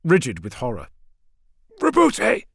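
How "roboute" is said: high, raspy call for help; strained, hoarse shout; rasped cry. A narrator's reading. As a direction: rasp the name out with great effort. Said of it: "Sanguinius rasped".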